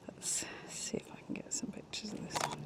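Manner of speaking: hushed whisper so nothing flies off. Real, not acted. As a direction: whisper softly near the insect. Creeping up on butterfly.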